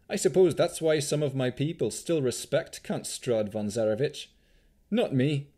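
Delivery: animated Vistani character voice; jaunty Vistani swashbuckler. A narrator's reading. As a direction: explain proudly as an accented adventurer.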